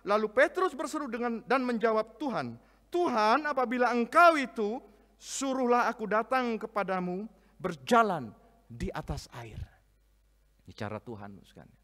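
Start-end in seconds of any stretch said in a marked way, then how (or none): 0.1-9.6 loud, high, fervent Bible reading; scripture read with force. Acted. Pastor reading the storm passage aloud, fervent. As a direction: proclaim the passage to the congregation.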